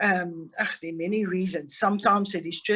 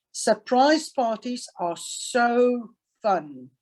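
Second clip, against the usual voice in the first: slow, stressed reading with weight on so; emphatic so; 4 semitones higher, slower than usual. Shown as a demonstration. Teacher models the sentence to the class, slow and stressed. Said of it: "So we will say".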